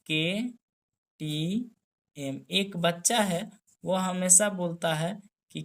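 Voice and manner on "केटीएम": केटीएम drawn out very slowly, letter by letter; slow spelled mnemonic. Shown as a demonstration. Teacher to students, spelling out KTM mnemonic, very slowly. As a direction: stretch each letter of केटीएम for the class. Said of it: "जिसका नाम है केटीएम".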